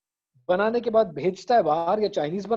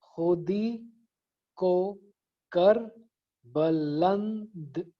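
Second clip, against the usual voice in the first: खुदी को कर बुलंद in slow separate beats; syllable by syllable; much slower than usual. Shown as a demonstration. Teacher counting out a couplet, syllable by syllable. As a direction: pause after each syllable of खुदी को कर बुलंद. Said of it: "मैं जरा अपने हिसाब से करता हूँ".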